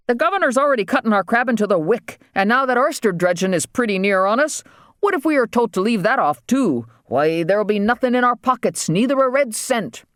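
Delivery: high-pitched rustic grievance; aggrieved island waterman. Acted. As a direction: complain high in a thick island dialect.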